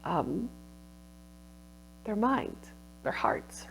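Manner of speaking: soft, fading wonder; awed hush. Retold or imitated. Textile researcher's awe at the artisan's vision, hushed. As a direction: let the words sink to near silence in awe. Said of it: "we had this moment where it was like".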